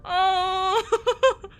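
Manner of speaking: high squealing laugh-cry. Real, not acted. Laughing hysterically.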